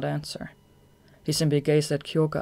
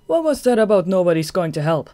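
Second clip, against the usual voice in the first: louder, teasing; mocking taunt; 4 semitones higher, 9 dB louder than usual. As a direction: throw his words back mockingly. Mocking new voice.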